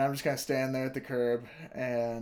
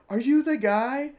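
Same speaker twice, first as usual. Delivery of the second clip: higher, lightly voiced curious girl; imitating a girl; 12 semitones higher, 7 dB louder than usual. Bystander girl asks if he was the one who carried her. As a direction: ask it like an eager stranger.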